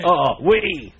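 put-on overdone stab at a French accent; French accent attempt. Trying to find a French accent, loud mock French. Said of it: "I can't remember how to do a French accent".

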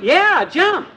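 high, urgent calling out; urgent shouting. Acted. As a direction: yell a warning to jump. Calling out again.

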